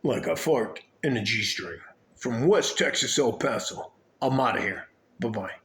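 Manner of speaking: put-on radio announcer sign-off. Shown as a demonstration. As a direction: sign off like a radio host, each phrase falling at the end. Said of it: "Here's my radio voice".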